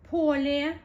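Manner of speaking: Поле said loud, rising at the end; Russian word demo. Shown as a demonstration. Teacher shows Поле to students, loud and rising. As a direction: call out Поле, lifting at its end. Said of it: "Поле is a field".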